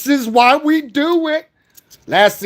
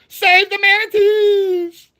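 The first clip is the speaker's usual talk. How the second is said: slow, high sing-song call; high drawn-out call; 10 semitones higher, much slower than usual. Acted. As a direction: draw it out up high. Repeats catchphrase.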